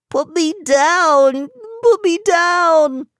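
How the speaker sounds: very high, loud, slow snail yell; desperate high shout to be put down. Acted. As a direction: scream it out as a tiny snail. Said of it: "Sammy kept yelling".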